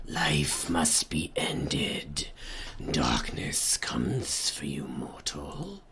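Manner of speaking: slow, low ghostly whisper; sinister shadow whisper. Pretend. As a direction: breathe it like a spectre from the dark. Voices the shadow enemies.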